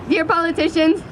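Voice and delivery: ordinary talk, nothing marked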